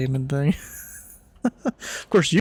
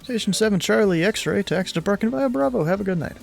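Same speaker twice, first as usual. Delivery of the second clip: ATC radio voice; 6 semitones higher than usual. Acted. Controller radio call.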